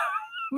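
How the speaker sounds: high squeaky giggle; squealing laugh. Real, not acted. Host cracking up over roach car, squealing high. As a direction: let the giggle escape high.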